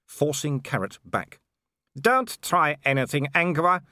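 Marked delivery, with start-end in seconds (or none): 2.0-3.8 high, grinning menace; a higher villain voice, taunting with glee; sneering brute enjoying the threat; gleeful werewolf villain. A narrator's reading. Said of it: "grinning happily".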